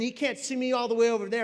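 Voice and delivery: ordinary delivery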